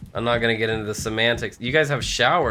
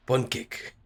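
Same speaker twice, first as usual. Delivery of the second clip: "Pancake" in an imitated Japanese accent; mock Japanese accent; 5 dB quieter than usual. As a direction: try the word with a put-on Japanese accent. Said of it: "was I supposed to read it like that?".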